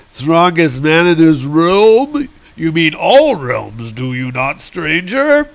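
slow, drawn-out mock disbelief; scornful echo. Hercules not recognizing Thor, slow scornful echo.

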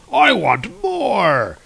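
high, slow greedy whine; greedy Volstagg. Retold or imitated. Revived severed head begs for food, slow high whine. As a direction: stretch it out like a hungry giant. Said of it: "He's like".

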